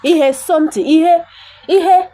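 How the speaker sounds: usual speaking voice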